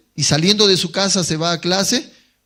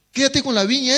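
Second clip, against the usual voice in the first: urging, pitched higher; tempting inner voice; 7 semitones higher, faster than usual. Retold or imitated. Tempting thought urges him to keep the vineyard, pitched higher. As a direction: press the temptation harder. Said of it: "otro pensamiento le dice".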